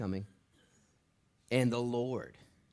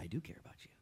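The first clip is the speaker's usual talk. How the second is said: soft whispered reassurance; the Lord comforting a frightened believer; tender whisper; 2 semitones lower, 11 dB quieter, faster than usual. Retold or imitated. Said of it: "And the Lord's like".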